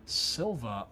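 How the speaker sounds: usual speaking voice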